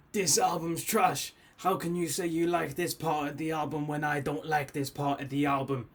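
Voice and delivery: a hint of a sneering hater reading his comment; mock hater comment. Mocking the comment section's haters, lightly voiced. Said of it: "people ... be like".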